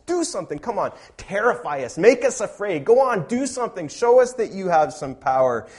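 high, loud, goading taunt; sarcastic mocking. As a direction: egg them on with mounting scorn, daring them to act. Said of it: "God is sarcastically mocking idols".

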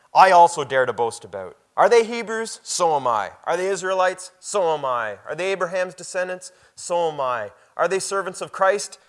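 loud, high, ringing scripture reading; preaching at full force. Acted. Pastor to congregation, reading Paul's boast, loud and ringing. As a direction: proclaim each question like a challenge.

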